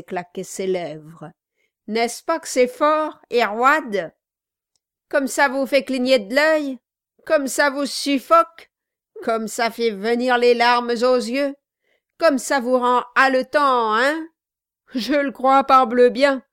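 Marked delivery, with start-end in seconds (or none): 1.9-14.3 loud, higher, insistent questioning; gleeful goading. A narrator's reading. Host teasing his guest about the fiery liquor, gleefully goading. 14.9-16.4 loud, higher-pitched emphatic cry; exclaiming. A narrator's reading. Guest gasping at the fiery drink, loudly exclaiming. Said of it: "s'écria Dick".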